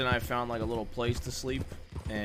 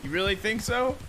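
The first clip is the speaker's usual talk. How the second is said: high, loud disbelief; really asking, incredulous; surprised; 11 semitones higher, 6 dB louder than usual. Real, not acted. Surprised question in game.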